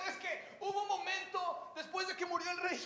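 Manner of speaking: breathless prophet spilling out what he saw, pitched high; high, wide-eyed telling of the throne vision; Isaiah telling it. Acted. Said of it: "Isaías de repente estaba ahí parado y dijo".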